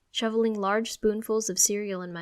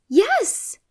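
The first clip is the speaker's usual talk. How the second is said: very high and loud, eager; excited yes; 11 dB louder than usual. Acted. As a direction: shoot back an eager yes. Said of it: "she answers quickly".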